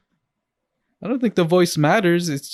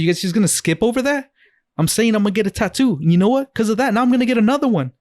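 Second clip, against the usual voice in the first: higher, faster, insistent; mock indignant excitement; 6 semitones higher, faster than usual. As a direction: push it, demanding attention. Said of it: "then I just say".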